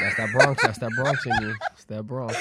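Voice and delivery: loud, laughing teasing call-out; laughing tease. Real, not acted. Cohost teasing her Bronx side, loud and laughing. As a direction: rib her through laughter. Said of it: "cohost teasing, laughing".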